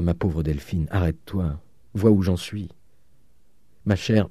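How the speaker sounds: plain reading voice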